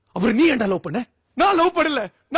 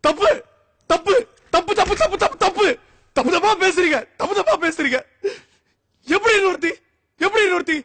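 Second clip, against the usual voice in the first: indignant protest; 6 semitones higher than usual. Acted. Man protests counselor's advice.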